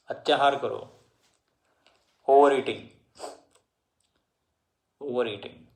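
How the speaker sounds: usual speaking voice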